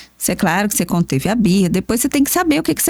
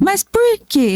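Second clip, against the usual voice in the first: voz aguda de criança questionando; criança insistente; 9 semitones higher, slower than usual. Retold or imitated. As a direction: imita o filho teimoso. Filho insistindo. (filho perguntando com voz aguda)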